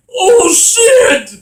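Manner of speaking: high, loud startled shout; shocked yell. Retold or imitated. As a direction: scream it in shock. Character falling, quoted.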